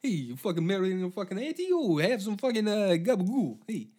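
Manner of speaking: big brash mock Italian swagger. Italian market guy at funeral, mock Italian accent. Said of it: "people are gonna be going around like".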